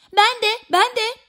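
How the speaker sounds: high, quick chiming-in cries; eager shout. A narrator's reading. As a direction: pipe up high to be included. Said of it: "diye bağırmış kirpiyle karga da".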